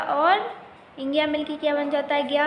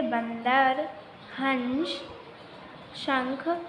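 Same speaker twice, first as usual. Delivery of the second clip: बंदर, हंस, शंख one by one, drawn out; slow, separated teaching examples; example words, spaced out; much slower than usual. Shown as a demonstration. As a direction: leave a clear pause after बंदर and हंस. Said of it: "अनुस्वार का उच्चारण नाक से किया जाता है".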